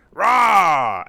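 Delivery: a short, loud mock dragon roar; loud imitated dragon roar. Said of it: "it just kind of goes".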